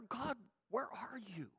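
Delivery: very soft, forlorn question; quiet, lost plea to God; hushed prayer. Acted. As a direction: ask it almost to yourself. Acted prayer, hushed second take.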